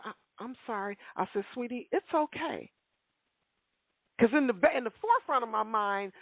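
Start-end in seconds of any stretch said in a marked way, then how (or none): 0.0-1.0 soft, small, scared apology; a terrified girl mumbling sorry; timid little girl. Retold or imitated. Frightened girl apologizing to the woman she bumped, meek and quiet. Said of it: "This little girl was terrified". 1.5-2.7 gentle reassurance. Retold or imitated. Comforting the frightened girl.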